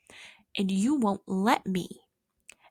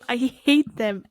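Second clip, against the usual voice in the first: louder hate; 3 semitones higher, 6 dB louder, slower than usual. Pretend.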